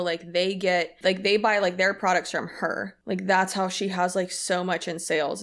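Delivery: usual speaking voice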